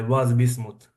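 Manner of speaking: ordinary talk, nothing marked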